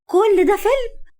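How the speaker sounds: high, indignant, stunned questioning; angry disbelief. A narrator's reading. Woman confronting lover over his trick, angrily stunned. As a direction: demand answers, pitch jumping way up. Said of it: "وبصت له بغضب وقالت بسهول".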